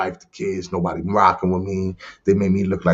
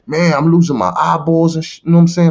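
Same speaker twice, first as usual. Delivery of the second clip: mad at God; 6 semitones higher than usual. Retold or imitated. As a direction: rant at God for ruining things. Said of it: "I'm mad at God".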